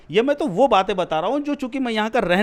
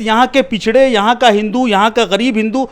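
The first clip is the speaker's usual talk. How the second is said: loud, higher-pitched indignant challenge; real exasperated outburst, loud and insistent; heated argument; 5 semitones higher, 7 dB louder than usual. Real, not acted. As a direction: pile up the points forcefully, voice raised.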